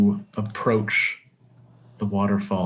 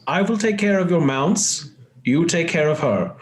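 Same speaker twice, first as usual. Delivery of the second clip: higher-pitched character voice, firm parting words; hearty companion; 8 semitones higher than usual. Acted. Character punches Altus in the arm, hearty parting voice. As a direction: send off a friend, pitched well up. Game master plays a character.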